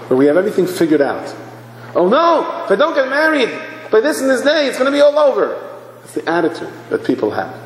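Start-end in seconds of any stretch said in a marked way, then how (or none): 1.9-5.5 high-pitched mock panic; worked-up imitation of a worrier; panicked fretting. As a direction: fret like it's all about to collapse. Acting out anxious marriage worry.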